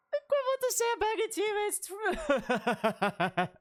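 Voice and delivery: high-pitched squeal through laughter; cracking up. Real, not acted. Streamer cracking up at own game comparison, laughing squeal. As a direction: squeak it out while breaking into laughter.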